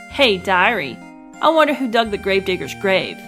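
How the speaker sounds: ordinary talk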